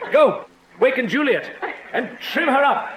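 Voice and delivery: high, cheery, laughing command; jolly bustling before the wedding; laughing order. Acted. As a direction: bark the order with a chuckle.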